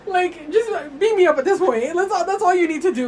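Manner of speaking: loud, high, exasperated joking; laughing mock plea. Real, not acted. Joking to the absent ex about heartbreak, laughing mock plea. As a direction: beg in jest, voice up and laughing.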